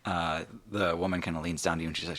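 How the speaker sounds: ordinary delivery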